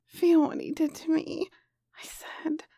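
soft unvoiced storytelling whisper; whispered narration. A narrator's reading. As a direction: whisper the story close to the mic.

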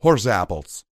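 warped through a held rifle; muffled mouthful. A narrator's reading. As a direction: talk with a gun in your teeth. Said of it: "Calamity's voice sounded so warped".

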